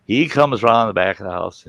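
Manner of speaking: usual speaking voice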